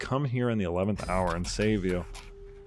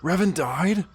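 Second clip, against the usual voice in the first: surprised; 10 semitones higher, 4 dB louder, slower than usual. Real, not acted. Learning of a death.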